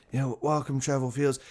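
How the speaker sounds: lightly imitated sleepy, nervous YouTuber; half-asleep vlogger. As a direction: mumble a welcome as if half asleep, holding back. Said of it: "It's like he's half asleep".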